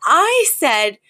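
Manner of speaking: lifted high, a fussy socialite's retelling; a socialite rehashing a spat, high and theatrical; high dramatic Cece. Retold or imitated. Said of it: "And she goes".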